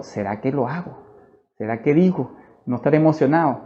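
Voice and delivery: softly voiced inner doubt; retold private deliberation, hushed; hesitant self-questioning. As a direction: wonder to yourself, low and unsure.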